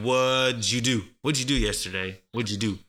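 "What'd you" blended into "wudju"; fuse "What'd you" into one word, "wudju"; linked native "What'd you do"; all combined. Shown as a demonstration. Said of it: "they all combine".

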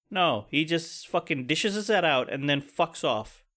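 ordinary delivery

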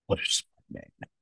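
whispered, impressed aside; imitating an awed listener, almost voiceless; hushed admiration. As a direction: murmur it in mock awe under your breath. Imagined hushed reaction to Bill Gates.

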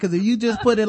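usual speaking voice

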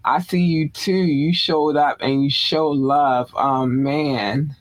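ordinary delivery

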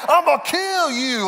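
very high, slow exaggerated threat; mock toy threat. Dad throws son's toy threat back, hammed-up menace. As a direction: stretch it out high, mocking the kid's menace.

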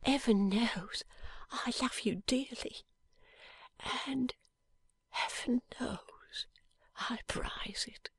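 slow, breathless whisper of a wounded man; dying man's whisper. A narrator's reading. Wounded husband whispering to his wife, faint and slow. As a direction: barely get the words out, fading.

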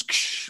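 hissy lightsaber "ksh"; mouth-made static burst of a lightsaber; imitated lightsaber noise. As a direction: make a quick saber crackle with the mouth. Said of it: "we've all made the lightsaber noises".

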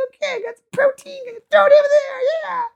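very high, frantic squeal; cartoon worker organ. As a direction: squeal it like an eager little helper. Acts out body handling protein, cartoonish.

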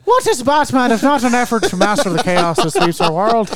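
hammed-up Scottish reading of Alfred's line; mock Scottish accent. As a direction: declaim the quote in a put-on Scottish burr. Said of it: "You went Scottish again".

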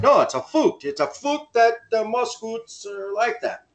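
hammed-up Canadian protest; mock Canadian accent. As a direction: imitate a Canadian insisting, with rounded oo vowels. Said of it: "you can't tell the difference between fact and fuck".

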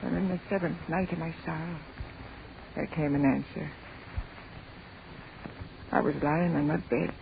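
soft, low, sorrowful recollection; hushed grief. Acted. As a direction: sink into a quiet, lonely memory. Grieving mother recalls the night alone.

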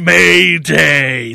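loud falling distress call; bracing for a crash. Acted. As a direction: shout the alarm, dropping at the end. Mock alarm call repeated.

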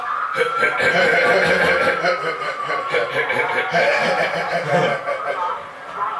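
high wheezy imitated chuckle; Seth Rogen laugh. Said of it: "can you make the Seth Rogen laugh".

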